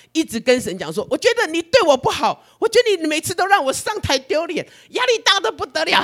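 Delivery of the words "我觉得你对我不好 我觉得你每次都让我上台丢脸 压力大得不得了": high, aggrieved whimpering voice; whiny complaint. Retold or imitated. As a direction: whine to God like a hurt child. (向神委屈哭诉的高声)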